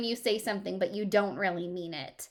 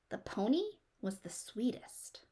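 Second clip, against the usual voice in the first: a soft whispered aside about the sweet pony; 2 semitones higher, 6 dB quieter than usual. A narrator's reading. A narrator whispering to children that the pony was the sweetest. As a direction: whisper it, fond and hushed. Fond memory of Stubby.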